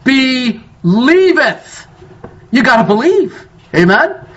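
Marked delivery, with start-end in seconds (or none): 0.0-1.8 "Believeth" stretched very slowly; drawn-out stress. Shown as a demonstration. Preacher naming the next word, "Believeth" slow and weighted. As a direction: hold "Believeth" long. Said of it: "What's the next word?".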